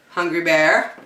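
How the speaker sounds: ordinary delivery, nothing marked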